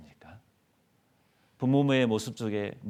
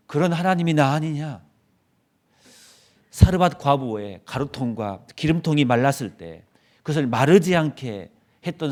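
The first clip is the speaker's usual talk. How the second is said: loud, higher, rising scolding as God; stern rebuke; 4 semitones higher, 8 dB louder than usual. Pretend. God scolding despairing Elijah, loud stern reproach. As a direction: thunder the reproach at a despairing prophet. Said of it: "저 같으면 그 책망했을 것 같아요".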